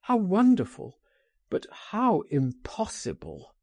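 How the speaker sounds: high rise on wonderful, then falling back; wistful cry. A narrator's reading. Man answers friend's offer of escape, a wistful cry. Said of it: "he cried".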